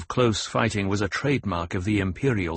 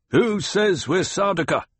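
high-pitched challenging demand; 9 semitones higher than usual. A narrator's reading. A cornered soldier challenges the duke, demanding. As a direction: throw the question back as a challenge. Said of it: "he demanded".

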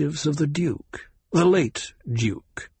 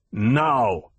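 growling. A narrator's reading. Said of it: "the Sardaukar growled".

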